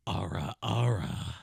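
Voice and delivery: whispered hissing snake; breathy sibilant serpent whisper. Pretend. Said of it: "aura in a snake voice".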